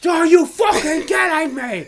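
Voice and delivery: high, loud, drawn-out chef yell; hammed-up incredulous shouting; mock furious shout. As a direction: explode like an outraged chef. Imitating chef's outburst.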